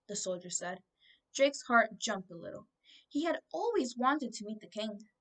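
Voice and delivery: ordinary delivery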